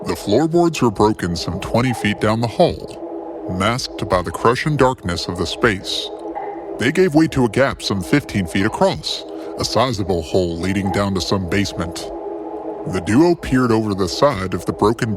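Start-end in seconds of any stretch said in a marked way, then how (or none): none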